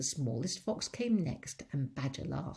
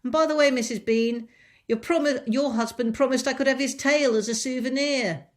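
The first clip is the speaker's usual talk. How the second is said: loud, high call with a stumble; 7 semitones higher, 12 dB louder than usual. Acted. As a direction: shout it upstairs, eager for the prize. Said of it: "she called out".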